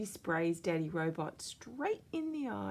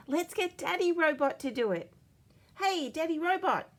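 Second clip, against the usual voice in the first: put-on high, loud eager child voice; high bright kid calling out; excited little girl; 12 semitones higher, 6 dB louder than usual. A narrator's reading. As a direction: pitch up into a keen kid. Narrator voices Bluey.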